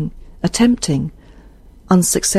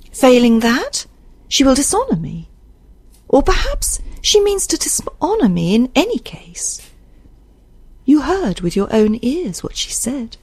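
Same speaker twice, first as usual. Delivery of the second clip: higher, anxious character voice; raised pitch, hurt and insistent; agitated Isabel; 6 semitones higher than usual. Acted. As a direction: spell out the threat with feeling. Reader voices Isabel in dialogue.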